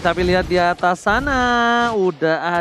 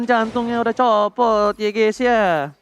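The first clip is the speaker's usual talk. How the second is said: ordinary delivery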